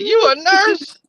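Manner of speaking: high-pitched mock disbelief; incredulous question. Man questioning his date's profession, incredulous. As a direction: question her job as if you can't believe it.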